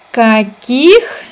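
usual speaking voice

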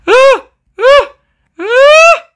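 high, loud frightened screams; panicked screams. Real, not acted. Chased by the monster.

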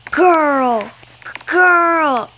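shocked shout at a rival. Real, not acted. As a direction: call it out loud, scolding. Excited gamer shouting at rival.

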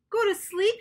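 high, loud excited shout; hammed-up happy shout. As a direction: Toad shouts in delight. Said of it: "shouted Toad".

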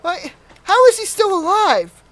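high, loud disbelief; incredulous outburst. Real, not acted. Player seeing his victim still alive, loud incredulous outburst. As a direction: exclaim in alarm and disbelief.

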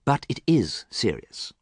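ordinary delivery

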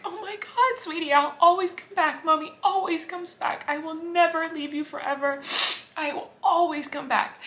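high teary voice, comforting; promising her son through real tears; choked-up high reassuring voice; tearful reassurance. Real, not acted. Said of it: "I was like".

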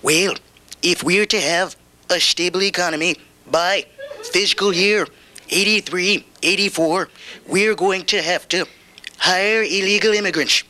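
slow, deliberate presidential drawl; president impression. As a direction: speak like a folksy president. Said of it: "telling you stuff like".

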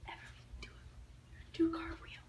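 hushed, voiceless whisper; stage whisper to coach the performer; whispered prompting. Real, not acted. Secretly prompting child what to do.

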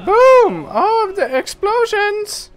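high, loud, drawn-out hollering; exclaiming at explosions. Real, not acted. Player reacts to game explosions, high excited yelling. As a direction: holler with over-the-top glee at a big blast.

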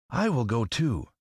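high scarecrow voice; volunteer in a high character voice. Acted. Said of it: "declared the Scarecrow".

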